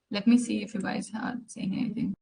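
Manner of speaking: soft, half-voiced aside to herself; under the breath. Real, not acted. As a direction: murmur it while checking the chat. Checking the class chat to herself.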